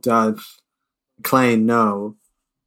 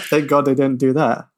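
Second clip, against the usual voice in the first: ordinary talk, nothing marked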